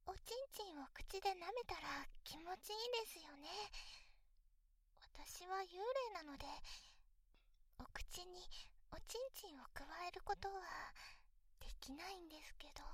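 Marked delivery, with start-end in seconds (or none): 2.4-12.8 ASMR whisper. Acted.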